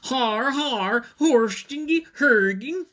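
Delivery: high, loud mock evil laughing chant; evil dinosaur laugh. Other dinosaur gloating over the plan, evil laugh. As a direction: cackle like a Norwegian villain. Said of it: "sounded like a big evil laugh".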